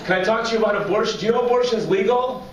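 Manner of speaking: put-on a teen eagerly pitching a stranger at his door, quick and bright; hammed-up eager pitch. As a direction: rattle off the pitch like a keen teenage canvasser. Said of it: "And this was my line".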